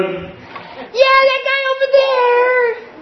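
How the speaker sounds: high, slow put-on voice of the big friend; mock tough kid, high and slow; big kid's voice. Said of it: "he'd go".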